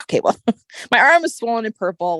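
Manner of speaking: high, laughing disbelief; incredulous laugh. Retold or imitated. Patient puzzled by her swollen purple arm, laughing in disbelief.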